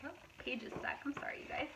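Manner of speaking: usual speaking voice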